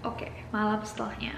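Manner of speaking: ordinary delivery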